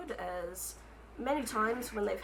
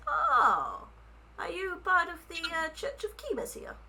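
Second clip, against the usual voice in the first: higher, eager little author voice; rabbit folk author voice; 10 semitones higher than usual. Acted. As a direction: play the shy, flattered rabbit writer. Rabbit author notices attire.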